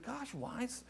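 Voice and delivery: high, soft, wondering voice; hushed inner thought. Retold or imitated. Seven-year-old thinking about his crying stepdad, hushed wonder. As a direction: think it aloud, small and high. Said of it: "I'm thinking".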